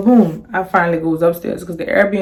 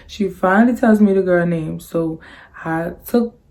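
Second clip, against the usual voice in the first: ordinary delivery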